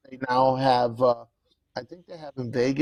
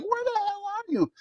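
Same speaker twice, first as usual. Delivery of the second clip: annoyed wife; 3 dB quieter than usual. Retold or imitated. Wife on phone, retold.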